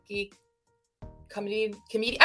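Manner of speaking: ordinary talk, nothing marked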